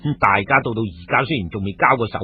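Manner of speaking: ordinary delivery, nothing marked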